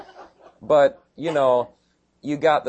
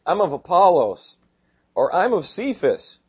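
mocking quote; 5 semitones higher, 5 dB louder, slower than usual. Mocking factional church members.

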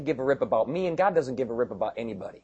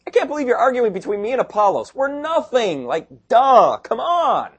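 high, loud incredulous outburst; exasperated Paul; 11 semitones higher, 6 dB louder than usual. Retold or imitated. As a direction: throw up your hands at the foolishness.

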